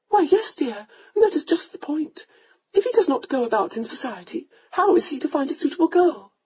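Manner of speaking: a mother coaxing her son to marry; countess. A narrator's reading.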